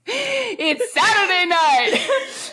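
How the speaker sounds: high, drawn-out showbiz yell; announcer shout. Pretend. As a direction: belt it like a TV host. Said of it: "mock show announcement".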